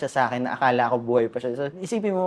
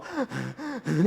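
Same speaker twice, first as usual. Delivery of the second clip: a short, softer gasp for air showing breathlessness; imitated gasp; 4 semitones higher, 6 dB quieter than usual. Shown as a demonstration. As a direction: act out a hungry gasp for breath. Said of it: "ganun na yung hinga mo". (ginagaya ang paghingal)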